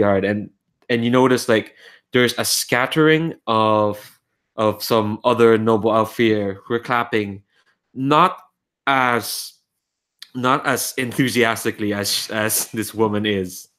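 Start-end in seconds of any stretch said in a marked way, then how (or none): none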